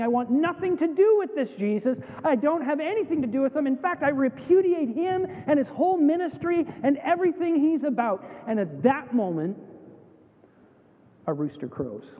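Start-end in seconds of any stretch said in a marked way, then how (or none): 0.0-8.2 high, vehement disowning; preacher acting out an insistent repudiation; emphatic renouncing. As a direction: disown him forcefully, pitched up throughout. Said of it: "This is renouncing".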